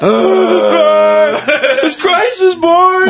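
high, drawn-out wailing cartoon impression; loud, hammed-up falsetto, laughing through it; Homer. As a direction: put on a high, slow cartoon voice. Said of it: "do you remember Homer?".

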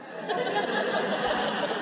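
breathy high-pitched laughter; high airy laugh. Real, not acted. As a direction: burst out laughing. Laughing at repeated question.

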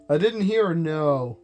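ordinary talk, nothing marked

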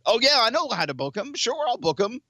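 high-pitched, overeager promoter voice; mocking local promoters. Cohost mimics promoters promising a booking, mocking high voice. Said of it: "I love all these local promoters going on".

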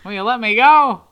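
put-on New York kid begging to go into showbiz; mock New Yorker. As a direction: beg mama in a New York accent. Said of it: "New Yorker".

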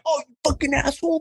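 high, fast mock outburst; mock angry local. Imagining someone offended by the prank, high fast mock outburst. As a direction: fire it off like an offended drinker. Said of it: "No one's like".

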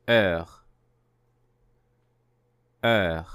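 Heure said with no H and a rounded vowel; model word. Shown as a demonstration. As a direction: say Heure slowly as a model for repeating. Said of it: "The first word means hour".

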